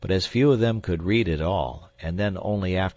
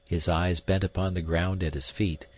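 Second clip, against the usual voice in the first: ordinary delivery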